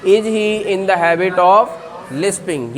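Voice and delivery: very slow, careful question; slow model question. Shown as a demonstration. Teacher drills the question very slowly for the class. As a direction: stretch it out for repetition. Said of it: "तो English में क्या बोलेंगे?".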